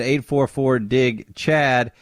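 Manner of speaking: slow, spelled-out phone number; slow repeat. Shown as a demonstration. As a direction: say the number again slowly.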